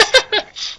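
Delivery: sudden high burst of laughter. Real, not acted. Friends reading fanfic, a high burst of laughter. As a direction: crack up high and breathless.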